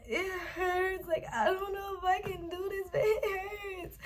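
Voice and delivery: high, tearful wail; crying. Retold or imitated. Retelling to viewers how she begged him in pain, crying high. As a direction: cry it out through the pain. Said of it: "I'm crying".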